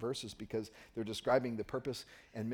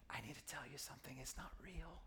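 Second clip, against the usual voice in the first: whisper; 3 semitones higher, 15 dB quieter than usual. Pretend. Confiding secret from Truman Show.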